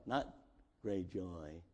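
great joy said low and soft, almost swallowed; muted joy. Shown as a demonstration. Mocking a timid church, great joy muttered quietly. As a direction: murmur great joy as if it were nothing special. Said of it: "No, no, no, no".

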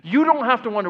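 usual speaking voice

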